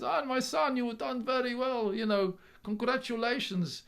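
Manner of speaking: high, slow proud Polish dad; Polish accent. Retold or imitated. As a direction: congratulate slowly in a Polish accent. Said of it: "I keep doing the Polish accent".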